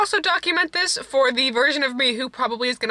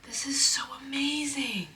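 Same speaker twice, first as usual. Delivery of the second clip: whisper; 4 semitones higher, 6 dB quieter than usual. Real, not acted. Whispering over new shoes.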